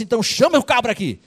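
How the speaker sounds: a furious king summoning the servant; king outraged at the unforgiving servant. Acted. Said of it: "O rei ficou revoltado".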